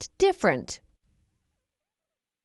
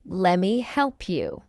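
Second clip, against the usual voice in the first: slow, measured "Lemme help you"; slow model; 3 semitones lower than usual. Shown as a demonstration. Teacher models the phrase for learners first, slowly. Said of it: "fast English is not difficult".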